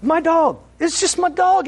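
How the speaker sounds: high excited shout of praise. Real, not acted. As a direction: burst out praising God. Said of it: "I'm all excited".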